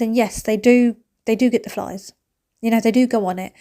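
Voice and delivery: ordinary talk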